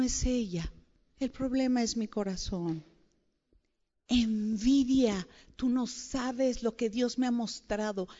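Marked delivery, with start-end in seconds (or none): 4.1-5.3 slow dramatic word. Acted. Naming her own envy, dramatized.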